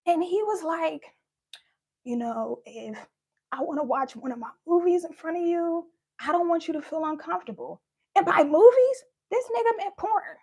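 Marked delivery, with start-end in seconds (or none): 2.0-7.8 lower, softer, creepy dad voice.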